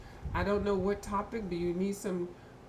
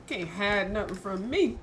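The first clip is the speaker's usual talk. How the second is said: usual speaking voice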